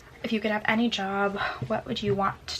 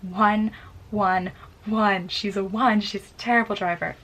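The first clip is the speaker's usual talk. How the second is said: loud mock verdict from imagined viewers; 6 dB louder, slower than usual. Mocking viewers loudly judging her driving. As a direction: jeer it like a harsh internet critic. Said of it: "be like".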